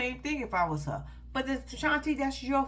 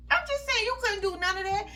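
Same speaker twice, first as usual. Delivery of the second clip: exasperated; 10 semitones higher than usual. Real, not acted. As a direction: throw up your hands. Closing the rant.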